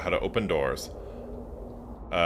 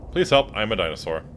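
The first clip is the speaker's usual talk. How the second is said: usual speaking voice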